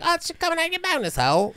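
high, quick mock British voice; British accent. Podcaster mocking how the UK would say it, British accent. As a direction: squeal it quickly in a put-on UK accent. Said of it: "the U K would be like".

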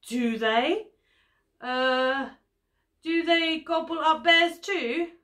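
storybook bear hesitating anxiously; nervous bear. A narrator's reading. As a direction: ask it uneasily, pitch climbing. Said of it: "asked the nervous bear".

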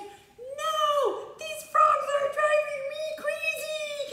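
very high, loud mock screech; frantic King Pharaoh. King overrun by jumping frogs, high frantic squeal. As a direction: squeal it in hammed-up exasperation. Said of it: "He said".

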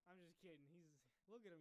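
usual speaking voice

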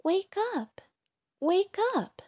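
very high, urgent little cry. A narrator's reading. Ladybug tries to wake Bear, crying out high. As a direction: pipe up to rouse a sleeper. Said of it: "cried Ladybug".

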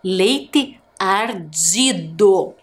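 leite ardido said slowly for dictation; slow and clear. Shown as a demonstration. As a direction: read it off slowly. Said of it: "observe a tela". (devagar, para ditado)

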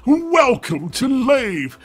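ordinary delivery

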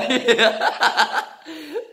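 high squealing giggle. Real, not acted. Laughing at the challenge.

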